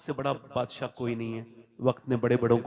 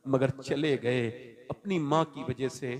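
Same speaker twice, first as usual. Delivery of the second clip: ordinary delivery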